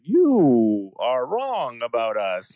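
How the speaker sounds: slow stilted broadcast voice; propaganda voice. Retold or imitated.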